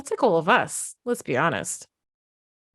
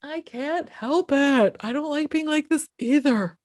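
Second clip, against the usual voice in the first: put-on pleading kid; 7 semitones higher than usual. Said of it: "they're like".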